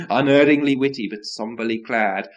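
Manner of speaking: ordinary delivery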